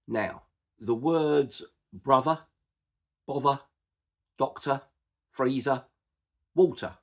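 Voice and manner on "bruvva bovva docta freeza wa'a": "brother, bother, doctor, freezer, water" with no R sounded at the ends; non-rhotic R. Shown as a demonstration. Teacher listing Cockney examples, "brother, bother, doctor, freezer, water" without final R. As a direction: end each of "brother, bother, doctor, freezer, water" on an open vowel, dropping the R. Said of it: "I don't pronounce the R at the end".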